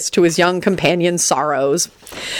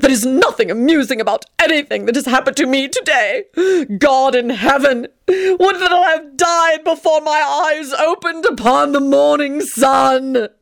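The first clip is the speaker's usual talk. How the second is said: melodramatic despair; 9 semitones higher than usual. Acted. Tony bemoaning his awful day, high and woeful. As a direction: moan like a tragic hero.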